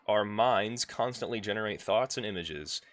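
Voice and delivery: ordinary delivery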